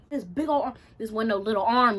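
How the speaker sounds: normal talking voice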